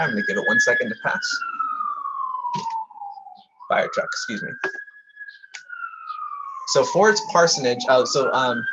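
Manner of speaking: talking over passing sirens. Real, not acted. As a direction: speak through the siren noise. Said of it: "I apologize for the ambulances driving by".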